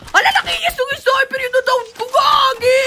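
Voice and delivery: high-pitched panicked gamer yell; alarmed shout. Real, not acted. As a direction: shout out in sudden alarm, voice shooting up. Spotting a sniper mid-game. (pasigaw na gulat, mataas ang boses)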